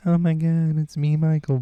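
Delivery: high-pitched interview voice. Retold or imitated. Pop star introducing himself in interviews, high put-on voice. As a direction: lift it high for a mock celebrity impression. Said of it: "in interviews, he's all like".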